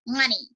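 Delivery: Money said with the M swapped for an N sound, lips apart; ventriloquist money. Shown as a demonstration. Ventriloquism coach demonstrating Money without lips. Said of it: "We go past the N, the M".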